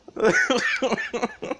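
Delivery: high-pitched, breathless giddy laughter; real overjoyed laughter. Real, not acted. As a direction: burst out laughing with joy. Overjoyed at a new console.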